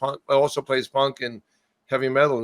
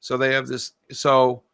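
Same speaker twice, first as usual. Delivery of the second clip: ordinary delivery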